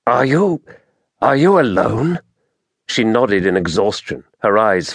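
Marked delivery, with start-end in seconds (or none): 0.1-2.2 hesitant, higher-pitched question in mild amazement; falter, then ask with rising disbelief; amazed, halting. A narrator's reading. Taras questions the wounded Merinda, amazed and halting. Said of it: "he said in amazement, stopping".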